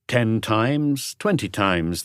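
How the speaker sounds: usual speaking voice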